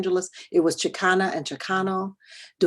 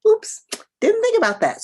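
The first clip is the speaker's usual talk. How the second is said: squeaky, much higher embarrassed oops; sheepish oops; 6 dB louder than usual. Retold or imitated. Telling the audience about her own blunder, sheepish high oops. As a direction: catch yourself in a silly slip, voice jumping up.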